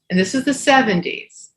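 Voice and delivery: ordinary delivery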